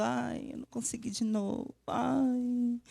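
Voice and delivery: half-hearted mock lament; mock defeated sigh. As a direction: moan it like someone giving up. Voicing a defeated believer.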